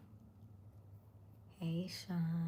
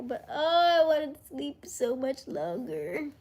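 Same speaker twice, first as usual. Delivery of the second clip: higher, louder wistful moan; wishing she could have slept longer, higher and louder; longing whine; 8 semitones higher, 7 dB louder than usual. Real, not acted. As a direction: whine about wanting more sleep. Wistful wish for sleep.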